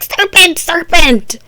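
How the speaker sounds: high, loud mock screech; alarmed bird shriek. Bird flaps at Alice, high mock shrieking. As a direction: cry out like a panicked bird. Bird flapping in her face, calling out.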